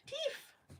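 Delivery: high squealed "Teeth!" full of delight; excited squeal. Real, not acted. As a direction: squeal the word "Teeth!" with glee. Excited about adding teeth.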